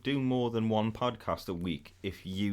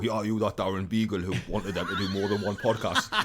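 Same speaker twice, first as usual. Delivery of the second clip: louder, laughing through a mock confrontation; laughing pretend callout; laughing mock accusation; 5 dB louder than usual. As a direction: accuse him while cracking up. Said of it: "go up to anyone and go".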